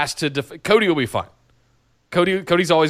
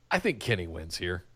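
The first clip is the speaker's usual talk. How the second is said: ordinary talk, nothing marked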